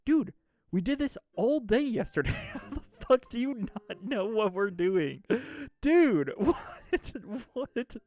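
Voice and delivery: high, loud exasperated rant; retold outburst breaking into incredulous laughter; angry disbelief. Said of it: "I'm so angry about this".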